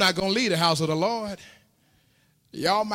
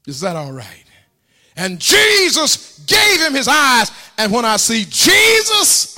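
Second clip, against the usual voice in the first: rising, louder preaching build; 3 semitones higher, 5 dB louder than usual. Acted. Preacher builds to full-force sermon.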